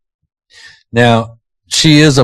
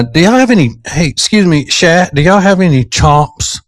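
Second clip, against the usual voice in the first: higher-pitched mock customer asking a clerk; example customer line; 8 semitones higher than usual. Shown as a demonstration. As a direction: call out a sample question, pitched up. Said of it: "you would say".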